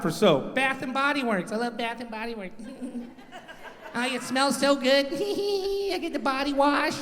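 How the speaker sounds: put-on soft, high girly voice; dainty feminine voice. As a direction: coo it daintily. Feminine voice for wife's soap.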